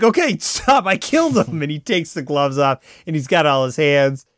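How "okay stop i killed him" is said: lightly acted, high-pitched plea to stop; mock panicked confession. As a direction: imitate a cornered man blurting it out. Cornered suspect confesses at gunpoint.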